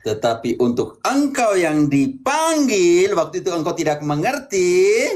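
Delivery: slow, stretched-out words with rising endings; slow, sing-out exposition; emphatic preaching. Real, not acted. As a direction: draw out the key words like a preacher. Priest expounds verse to Gisel emphatically.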